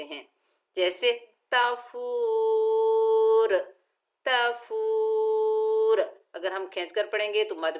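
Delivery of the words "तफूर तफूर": तफूर with the long u held; stretched madd. Shown as a demonstration. Teacher demonstrating madd arid in तफूर. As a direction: draw out the u in तफूर. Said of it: "दो अढ़ाई अलिफ की मिकदार तक भी खींच".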